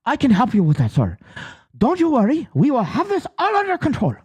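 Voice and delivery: high, hammed-up Chinese accent; badly faked Chinese accent, rising high; mock Chinese accent. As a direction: reassure the caller in a put-on Chinese accent. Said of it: "that's my best Chinese accent".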